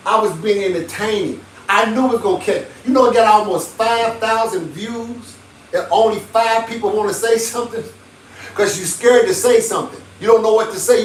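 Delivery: ordinary delivery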